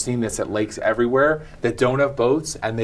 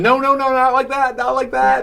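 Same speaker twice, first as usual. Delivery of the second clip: raised, higher mock yell of a bossy husband; 13 semitones higher, 4 dB louder than usual. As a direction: imitate a husband shouting orders at the boat launch. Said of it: "and then he's yelling".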